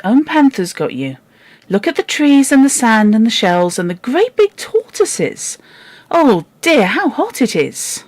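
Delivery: put-on comforting girl. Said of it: "she said".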